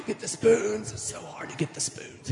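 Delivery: whining get the spoons; mock whiny kid. Kid whining about setting the table, mock whine. Parent coaxing kids to set table.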